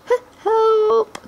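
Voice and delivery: high, panicked stammered cry for help; frightened cry. A narrator's reading. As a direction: yelp as a dagger hits your back. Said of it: "cried the prince".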